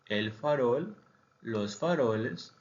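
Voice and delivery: ordinary talk, nothing marked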